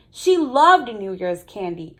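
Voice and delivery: plain reading voice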